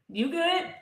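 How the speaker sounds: high-pitched little check-in; probing friend. Acted. Checking on someone who claims to be fine, probing. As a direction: lift the pitch, asking after them.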